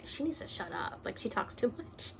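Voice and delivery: hushed, breathy inner voice of the caveman; whispered caveman thoughts. Retold or imitated. Retelling the caveman's thoughts from reviews, whispered. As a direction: breathe it like a thought in his head. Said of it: "he is always saying".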